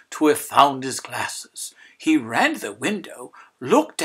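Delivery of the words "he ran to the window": plain reading voice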